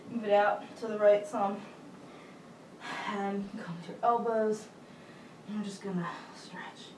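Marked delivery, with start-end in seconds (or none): 5.5-6.9 soft breathy aside; whispering. Real, not acted. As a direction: whisper it to yourself. Quiet aside mid pose.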